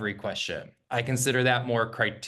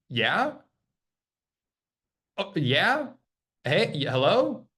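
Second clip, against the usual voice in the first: confused answer; 4 semitones higher, slower than usual. Retold or imitated. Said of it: "when they go".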